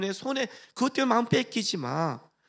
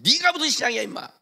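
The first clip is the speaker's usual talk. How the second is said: loud, higher scornful jab; mock taunt; 6 semitones higher, 4 dB louder than usual. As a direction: taunt him with contempt. Deaconess taunts believer.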